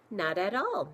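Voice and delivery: insistent. A narrator's reading. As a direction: insist on it, raising your voice. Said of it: "Mister Geordie insisted".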